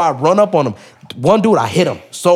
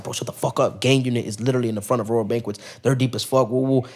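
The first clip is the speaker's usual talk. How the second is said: much softer urgent hush; hushed warning; 2 semitones lower, 6 dB quieter than usual. Retold or imitated. Homie warning him that police are out front, hushed. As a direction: keep it down, police nearby.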